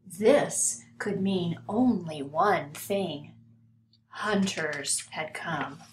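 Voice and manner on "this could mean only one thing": ordinary delivery, nothing marked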